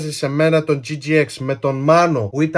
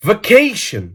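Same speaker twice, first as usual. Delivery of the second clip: VACATION higher, in a fluent English accent; authentic English accent; 5 semitones higher than usual. Shown as a demonstration. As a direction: say "Vacation" like a native English speaker. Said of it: "μιλάω με αυτή την προφορά".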